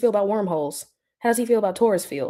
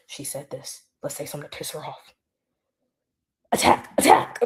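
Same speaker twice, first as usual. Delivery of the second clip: scheming Russian spies; 5 semitones lower than usual. Retold or imitated. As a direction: play a spy plotting to provoke her. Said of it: "they'd be like Russian spies".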